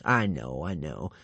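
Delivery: low, weary sigh. A narrator's reading. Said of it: "sighed Alvin".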